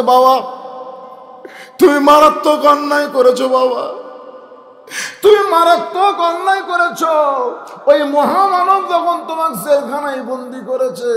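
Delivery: high, loud wail at father; crying out. Acted. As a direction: cry and reproach him at once. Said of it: "কাঁদতেছে আর বলতেছে". (কেঁদে কেঁদে চিৎকার)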